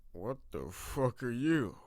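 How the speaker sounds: put-on slow, slurred drunk voice; drunken slur. Drunk Seamus on the floor asking the intruders, slurring slowly. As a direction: drag the words like a drunk. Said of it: "he slurred, clearly drunk".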